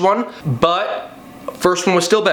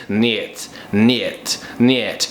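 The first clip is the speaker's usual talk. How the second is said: low, heavy Russian "Niet"; deep imitated Russian refusal; mock Russian accent; 7 semitones lower, much slower than usual. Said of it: "a Russian guy says".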